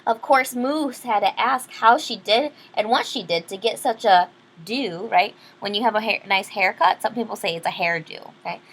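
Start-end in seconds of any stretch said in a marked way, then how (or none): none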